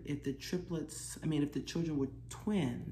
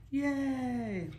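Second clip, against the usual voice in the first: cheer; 8 semitones higher than usual. Real, not acted. Cheer after song.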